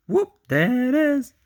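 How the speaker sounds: very high, loud whoop; high-pitched, playful cheer; playful catchphrase. Acted. Repeats catchphrase as playful exclamation.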